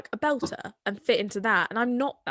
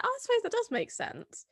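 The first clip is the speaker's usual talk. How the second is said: quoted in a much higher, softer voice; high surprised realisation; 4 dB quieter than usual. Retold or imitated. Recalling the accent teacher's advice, much higher surprised realisation. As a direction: lift way up in pitch and soften, as if it just dawned on you. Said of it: "And I was like".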